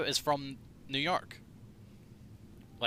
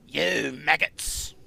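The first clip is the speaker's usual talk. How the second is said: slow, English-sounding take; accidental English accent; 4 dB louder, much slower than usual. Retold or imitated. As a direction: drag it out with an English lilt. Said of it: "that's more English".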